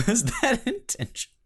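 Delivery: asked high through real laughter; can't stop laughing while asking; giggling disbelief, voice jumping up. Real, not acted. Laughing at a burp on podcast.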